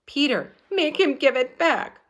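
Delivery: high, loud plea for help; pleading cry. A narrator's reading. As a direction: beg him to step in. Girl begging in story.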